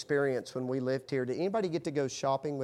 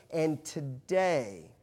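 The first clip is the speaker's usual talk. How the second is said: slow buildup; much slower than usual. Acted. Preacher slowing to build suspense before a statistic. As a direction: draw out today very slowly.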